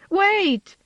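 high loud shout. A narrator's reading. Siblings chase the flying kitten, shouting high. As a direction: yell after the runaway. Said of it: "they shouted".

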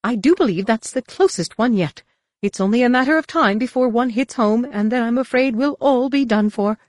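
high, fussy, anxious droid voice; a prim robot butler fearing doom; C-3PO fretting. Acted. C-3PO frets about near misses.

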